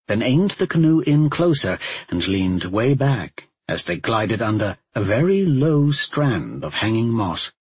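plain reading voice

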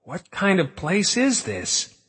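high, slow frightened cry; panicked question from the dark. A narrator's reading. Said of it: "somebody behind them cried".

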